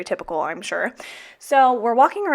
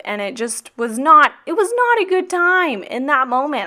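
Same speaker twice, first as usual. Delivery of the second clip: real anger flaring up, higher and louder; still mad; 6 semitones higher, 5 dB louder than usual. Real, not acted. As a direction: vent the leftover rage. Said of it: "I'm still so mad about that Buc-ee's incident".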